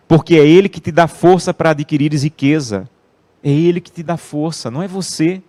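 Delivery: loud, emphatic pulpit delivery, slowing on key words; preaching at full force. Acted. Preacher exhorting his congregation, loud and emphatic. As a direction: drive the scripture home to the church.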